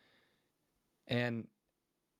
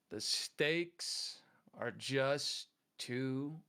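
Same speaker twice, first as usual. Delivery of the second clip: slow punchline; much slower than usual. Acted. Punchline of the joke.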